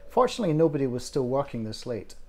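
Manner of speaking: plain reading voice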